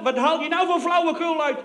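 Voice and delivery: a general yelling down the phone, hammed-up; mock roaring general. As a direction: bellow down the phone like an irate general. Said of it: "brult die gozer in mijn oor".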